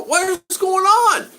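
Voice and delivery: high, loud, drawn-out mock exclamation; baffled outcry. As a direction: cry out in exaggerated bewilderment, stretching the words. Retelling his baffled outcry.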